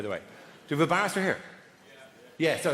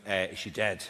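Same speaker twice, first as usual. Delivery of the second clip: low, softer guessing voice; imitated stage psychic; 11 semitones lower, 5 dB quieter than usual. Failing psychic asking about a sister, low and soft. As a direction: guess it quietly, well down in pitch. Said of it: "And Ewan goes".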